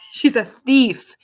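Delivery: slow, loud playful charge of THIEF; mock accusation. Real, not acted. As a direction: draw out THIEF in playful outrage. Jokingly accusing her little daughter.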